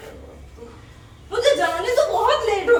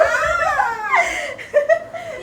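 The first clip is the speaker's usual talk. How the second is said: high, drawn-out laughing wail; whining wail; 9 semitones higher than usual. Real, not acted. Teased sister protesting to her sister, high laughing wail. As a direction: let out a long protesting squeal.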